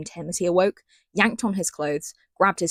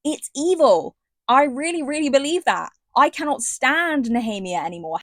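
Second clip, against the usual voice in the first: heated, raised indignation; indignant; 6 semitones higher than usual. Real, not acted. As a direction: declare it evil with real heat. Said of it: "outraged rant".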